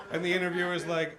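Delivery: ordinary talk, nothing marked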